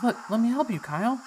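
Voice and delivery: stammered plea to stay; pleading Dora. Acted. Dora begging Kyle to let her help, high. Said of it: "And she goes".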